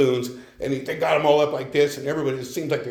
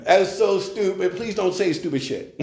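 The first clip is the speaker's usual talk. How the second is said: higher-pitched dismissive retort; mock exasperation; 5 semitones higher than usual. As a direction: scold him in a raised pitch, half in jest. Retort to his sponsor, retold.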